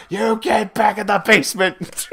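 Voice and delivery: high-pitched hammed-up scolding; mock angry order. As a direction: banish them in comic outrage. Acted shooing of unwanted character.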